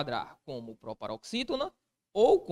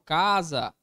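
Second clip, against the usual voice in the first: "Casa" loud, with the CA leaned on; stressed syllable demo; 8 dB louder than usual. Shown as a demonstration. Teacher giving the class an example, loud stress on the CA of "Casa". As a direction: punch out CA in "Casa". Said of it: "onde que está a minha sílaba tônica".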